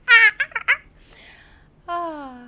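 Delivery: normal talking voice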